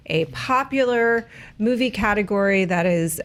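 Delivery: ordinary delivery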